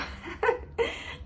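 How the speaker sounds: a real, airy laugh up high; breathy high laugh. Real, not acted. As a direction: laugh out at your own joke. Laughing at own joke.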